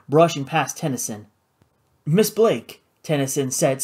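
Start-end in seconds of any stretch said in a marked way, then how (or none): 2.0-2.8 higher, mildly surprised greeting; startled. A narrator's reading. Tennyson meeting Flora unexpectedly, startled. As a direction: greet her as if caught off guard.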